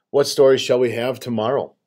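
ordinary delivery

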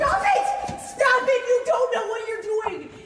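high, frantic yell; shrill shouting. Real, not acted. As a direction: scream at him to stop. Woman accuses man in her home.

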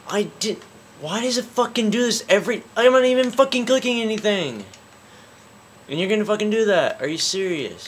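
fast, high, loud frustrated rant; real gamer rage. Real, not acted. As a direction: yell at the game in outraged disbelief. Gamer raging at misbehaving controls.

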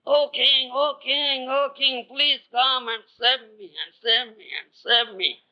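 high wailing cry for help, hammed-up; mock crying plea. Sadhu pretending pain, crying out to the king in a high wail. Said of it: "and cried".

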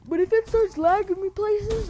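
talking through a big yawn; words stretched by a yawn. Real, not acted. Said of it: "I hate talking when I yawn".

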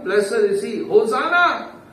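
high, ringing cheer of praise; crowd praising. Retold or imitated. Preacher quotes Jerusalem praising Jesus, high exultant cry. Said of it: "before Jerusalem praising him".

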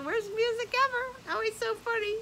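high, tickled amused voice. Real, not acted. Vlogger amused at her own bad music choice, high-pitched. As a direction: say it still giggling.

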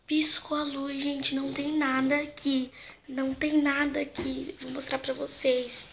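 hushed. Real, not acted. Tense ghost hunt, hushed.

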